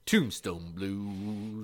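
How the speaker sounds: reading a story title for the list, low and drawn out; low, slow, softly stretched title. Pretend. Co-host announcing Tombstone Blues for the list, low croon.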